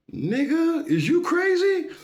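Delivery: high, loud disbelief; incredulous inner voice. Retold or imitated. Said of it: "thinking in my head like".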